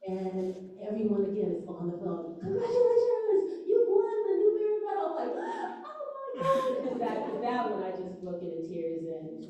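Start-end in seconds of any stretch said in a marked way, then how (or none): 2.4-5.1 high, loud chorus-like announcement; excited congratulations. Retold or imitated. Committee announcing the second award. 5.4-6.9 very high, slow squeal; overwhelmed amazement. Retold or imitated. Own reaction to second award news.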